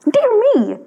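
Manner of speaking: high, startled exclamation; a pompous official taken aback; surprised mayor. Acted. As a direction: gasp it out in fussy surprise. Mayor sees the shabby statue.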